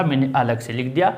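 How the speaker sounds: ordinary talk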